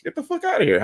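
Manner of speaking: a squeaky, disbelieving brush-off; high exasperated dismissal. Real, not acted. Ranting against crowdfunding.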